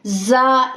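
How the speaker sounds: जा with a hissing whistle on the ज़; whistling za. Shown as a demonstration. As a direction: let the ज़ in जा whistle. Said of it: "whistle sound". (जा में सीटी की आवाज़)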